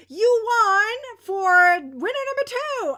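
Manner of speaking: loud, high, drawn-out winner call; excited announcement. Real, not acted. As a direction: proclaim the winner slowly and loudly.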